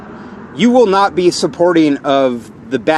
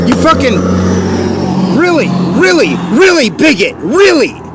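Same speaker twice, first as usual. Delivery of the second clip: angry outburst; 8 semitones higher than usual. Real, not acted. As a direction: spit it out in real anger, pitched way up. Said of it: "you wonder why I'm angry".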